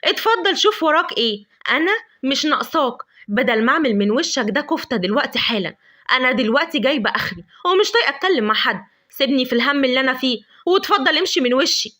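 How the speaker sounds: loud, sharp angry dismissal. Acted. Lavender angrily sending Adham away, loud and sharp. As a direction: tell him off and send him away. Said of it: "ردت عليه بعصبية وغضب".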